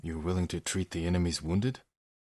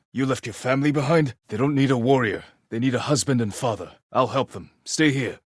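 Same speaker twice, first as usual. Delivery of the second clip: urgent pleading; 4 semitones higher, 5 dB louder than usual. Acted. Urging a man to go home.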